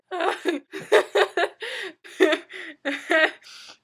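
high, loud, long burst of laughter; real laughing fit. Real, not acted. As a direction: crack up helplessly at your own joke. Laughing at her own joke.

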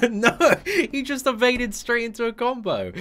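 high, loud, laughing through the words; exasperated laughing. Real, not acted. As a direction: laugh it off after the rage. Laughing after the outburst.

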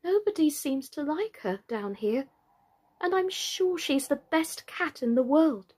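put-on high, plaintive girl's voice; melancholy. A narrator's reading. Alice alone, missing her cat Dinah, melancholy. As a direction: pine aloud, drawing out the words sadly. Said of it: "said to herself in a melancholy tone".

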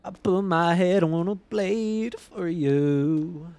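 very slow, drawn-out dramatic line; mock-tragic lament. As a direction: stretch each word like a tragic hero.

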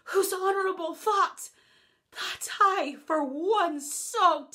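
impassioned Shakespeare delivery, slow in places; heightened theatrical verse; classical verse speech. Acted. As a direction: declaim it like a wronged king on stage.

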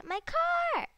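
high, loud yelp; a squeaky outburst over a game moment; sudden cry. Real, not acted. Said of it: "that's a really weird thing to say".

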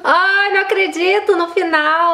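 high, wailed exclamation; dismayed disbelief. Real, not acted. Failing at the end of the level.

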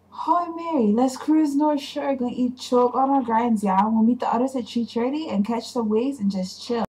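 put-on light Hawaiian pidgin accent. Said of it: "my best impression of a Hawaiian pidgin".

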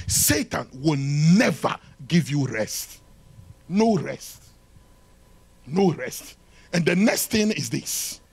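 ordinary talk

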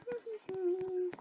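quiet high giggle; soft giggle. Real, not acted. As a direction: giggle softly. Laugh after getting punch.

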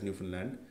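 "Newfoundland" with the middle reduced to "fin"; "Newfoundland" as locals say it, no "found" sound; local pronunciation. Shown as a demonstration. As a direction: say "Newfoundland" like "NEW-fin-land". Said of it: "I'm going to pronounce it as".

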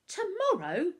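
high, loud squeaked question; alarmed squeak at the short deadline. A narrator's reading. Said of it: "Bertolt squeaked".

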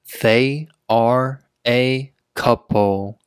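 slow, word-by-word reading; slow teaching pace. Shown as a demonstration. Teacher showing learners "they are a couple", word by word. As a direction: set every word apart for learners.

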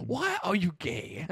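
soft, slow cartoon sage with African accent; lightly put-on wise mandrill voice, drawn out; Rafiki. Cartoon shaman puts the question, softly imitated African accent. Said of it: "I imagined Rafiki from The Lion King saying".

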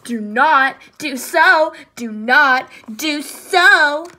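loud, punchy back-and-forth squabble; childish bickering. A narrator's reading. Lion and Alligator contradict each other, bickering. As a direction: punch out each retort in turn.

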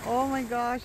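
normal talking voice

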